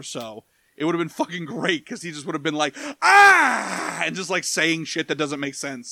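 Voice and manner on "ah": sudden loud high-pitched shriek; wild mock yell; crazed yell. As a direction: let out an unhinged cry. Imitating crazy Matt Hardy.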